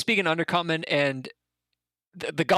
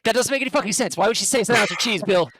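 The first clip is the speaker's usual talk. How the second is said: high, flustered goblin rant; exasperated goblin; 6 semitones higher, 4 dB louder, faster than usual. Acted. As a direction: defend himself like a cornered goblin. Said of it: "I was like".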